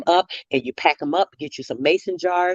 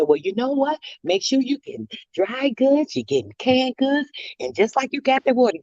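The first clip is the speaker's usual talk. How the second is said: lightly voiced old lady, higher pitched; sweet old lady; 7 semitones higher than usual. Old lady advising a shopper what to stock, lightly imitated. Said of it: "She was a sweet little old lady too".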